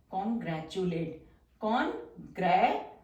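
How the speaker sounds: Congratulate spoken slow and soft; drawn-out word. Shown as a demonstration. Teacher slowly sounding congratulate while writing the outline.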